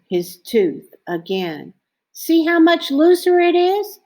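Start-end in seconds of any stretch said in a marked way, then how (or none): 2.1-4.0 high, excited boast; proud and eager to show it off. A narrator's reading. Arthur showing parents his tooth, eager. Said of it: "he told his parents".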